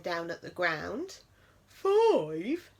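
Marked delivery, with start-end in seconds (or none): 1.7-2.7 high, louder puzzled bear. A narrator's reading. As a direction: ask it baffled. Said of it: "the bear said, puzzled".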